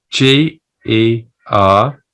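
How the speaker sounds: slow, letter by letter spelling. Shown as a demonstration. Spelling gerbil for a colleague, slow and letter by letter. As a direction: spell each letter out clearly and slowly. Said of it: "could you spell the word for me".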